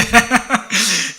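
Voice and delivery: loud, high burst of laughter; laughing. Real, not acted. Preacher giving up on the slide mix-up, laughing.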